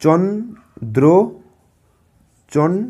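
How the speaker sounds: very slow, stretched Chandra; drawn out slowly. Shown as a demonstration. Teacher sounding out Chandra for pupils, very slowly. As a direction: pull the word out slowly. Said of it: "তারপর এটা দেখো".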